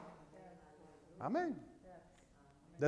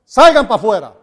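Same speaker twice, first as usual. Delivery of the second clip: commanding; 3 semitones higher, 12 dB louder than usual. Retold or imitated. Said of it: "los sacó a todos pa' afuera".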